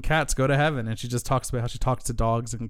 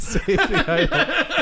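laughing; 5 dB louder, much slower than usual. Pretend. Repeating the mock last words.

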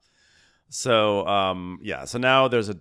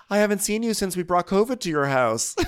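higher, lightly voiced cheer; mock bright greeting; 10 semitones higher, 4 dB louder than usual. Imitating Kyle greeting Harry. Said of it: "And Kyle goes".

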